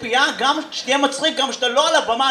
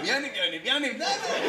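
much softer, pleading name-calls; hushed mock plea; 10 dB quieter than usual. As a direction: softly beg him to stop. Acted plea to a heckler. (תחינה שקטה ומשוחקת)

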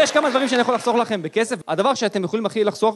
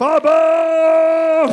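long, high, loud drawn-out call of אבא; stretched shouted child's cry; child calling dad; 7 semitones higher, 7 dB louder, much slower than usual. Acted. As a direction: yell across the house like a toddler.

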